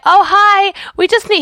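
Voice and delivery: high, loud, bright "excuse me" voice; acted high, eager hello; cheery polite greeting. Polite approach to the bus driver.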